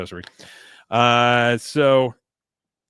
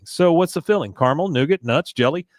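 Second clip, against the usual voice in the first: eager quiz; 5 semitones higher, 3 dB louder than usual. Retold or imitated. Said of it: "He says".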